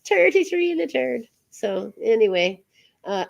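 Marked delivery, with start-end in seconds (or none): 0.1-1.2 "Thirty-three and a third" in a higher Irish accent, th like t. Shown as a demonstration. Host teasing Jenny with the Irish accent, laughing. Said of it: "I can do the Irish accent".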